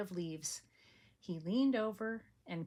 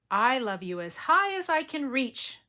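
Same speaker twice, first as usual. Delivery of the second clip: eager reach; 6 semitones higher, 8 dB louder than usual. Acted. Little hare reaching up high.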